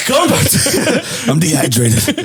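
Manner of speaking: words squeezed out through laughter; real laughing fit. Real, not acted. Storyteller resuming the tale for amused friend, laughing helplessly. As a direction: try to talk while cracking up.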